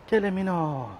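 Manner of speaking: high, loud call across the park. Real, not acted. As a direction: call the cat's name out loud. Calling cat again, louder. (lauter Ruf nach der Katze)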